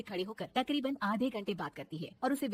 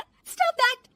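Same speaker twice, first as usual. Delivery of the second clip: high, loud exclamation; triumphant shout; 11 dB louder than usual. Acted. Daughter-in-law at the mirror shows off her power, triumphant. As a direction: proclaim the magic words boldly.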